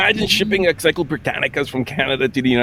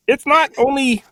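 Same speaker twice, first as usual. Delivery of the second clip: bad Kermit voice; 9 semitones higher than usual. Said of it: "despite my horrible accent".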